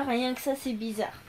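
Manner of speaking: ordinary talk, nothing marked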